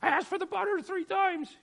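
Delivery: high-pitched, loud mock complaint; imitating a squabble at the table; mock scolding. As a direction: snap it like a fed-up diner. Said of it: "telling somebody else".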